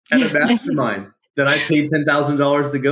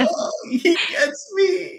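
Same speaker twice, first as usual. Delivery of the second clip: very high, drawn-out mock-tearful squeak; hammed-up teary voice, slow and high; tearing up; 3 dB quieter, slower than usual. Telling how being understood moved him, mock tearful. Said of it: "I started, like, tearing up".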